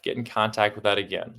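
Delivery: usual speaking voice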